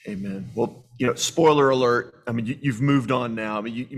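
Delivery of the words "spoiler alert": spoiler alert said slow and louder; playful announcement. Real, not acted. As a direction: lean into spoiler alert like a teaser. Host teasing the guest's news.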